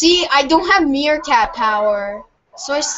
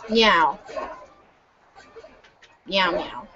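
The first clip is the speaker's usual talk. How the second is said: short, playful spoken meows; meowing; 5 semitones lower, 7 dB quieter than usual. Pretend. Player justifying the meerkat game, meowing. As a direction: toss out pretend cat meows. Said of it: "I'm just meowing".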